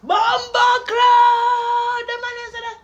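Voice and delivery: long high drawn-out yell; high stretched-out cheer; excited shout. Real, not acted. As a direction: scream the cheer, stretched out slowly. Shouting at the punchline.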